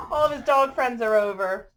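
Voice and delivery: plain reading voice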